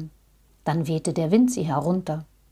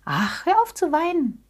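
high, comforting little voice; consoling rabbit; 8 semitones higher than usual. A narrator's reading. Rabbit comforting the crying bear, high consoling voice. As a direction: soothe in a high friendly voice. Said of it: "sagte das Kaninchen".

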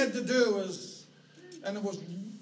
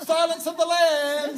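higher, louder sing-song names, laughing; calling sheep by name; 7 semitones higher, 9 dB louder than usual. Retold or imitated. Shepherd calls pet sheep by name, laughing louder call. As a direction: call each pet lamb over, chuckling. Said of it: "your voice was heard calling their names".

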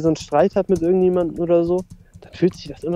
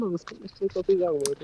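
trailing off; 6 dB quieter, faster than usual. Real, not acted. Losing the thread, forgetting.

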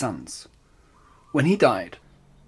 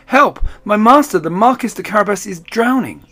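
high, loud panicked cry; shouting for help; 8 semitones higher, 7 dB louder than usual. Acted. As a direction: yell that the master is drowning.

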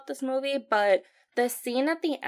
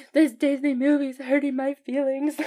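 higher, louder mock wail; hurt feelings; 4 semitones higher, 5 dB louder than usual. Grieving viewer upset by the film, mock wailing. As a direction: whine it like a wounded viewer. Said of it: "I was just like".

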